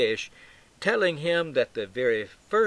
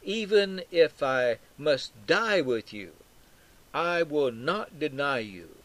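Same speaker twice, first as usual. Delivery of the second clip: slow, raised-pitch solemn vow; growing insistence; 3 semitones higher than usual. Acted. Peter's defense to Jesus growing stronger, insistent. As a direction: press each word as a firm pledge. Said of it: "louder and louder".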